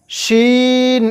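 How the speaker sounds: usual speaking voice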